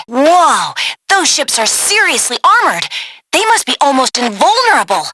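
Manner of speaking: usual speaking voice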